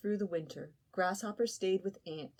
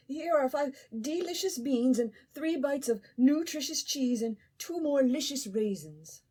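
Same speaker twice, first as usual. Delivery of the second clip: higher, tempting little host offer; coaxing Ant; 6 semitones higher, 5 dB louder than usual. Acted. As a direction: tempt him to eat. Ant coaxing sick friend to eat.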